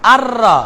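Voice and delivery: আর রা with a firm doubled r; doubled r, nun silent. Shown as a demonstration. Teacher shows the nun vanishing, doubled r in আর রা. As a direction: join আর রা on a held r, no n at all. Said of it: "গায়েব".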